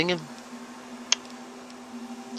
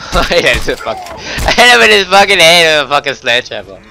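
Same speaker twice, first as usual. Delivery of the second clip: shouting; 5 semitones higher, 11 dB louder, faster than usual. Retold or imitated. Gamer quotes a character line.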